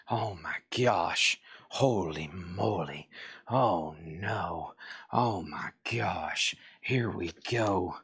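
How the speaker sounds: low, slow, hushed murmur of wonder over the records; slow down and marvel under your breath; hushed awe. Real, not acted. Record seller digging through a tub, murmuring in hushed awe.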